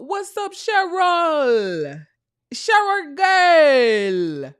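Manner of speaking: put-on high, drawn-out Jamaican accent greeting. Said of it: "my Jamaican accent".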